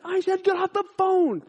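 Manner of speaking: high, loud mock shout; hammed-up gunman demand; angry repeated order. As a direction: act the robber losing patience. Retold gunman repeats his order.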